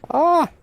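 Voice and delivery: big sudden sneeze; loud sneeze. Real, not acted. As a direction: let out a full sneeze. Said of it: "I'm gonna sneeze again".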